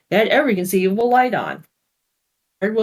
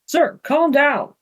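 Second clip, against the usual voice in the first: calming; 10 semitones higher, much slower than usual. Acted. Ogre calming armed father.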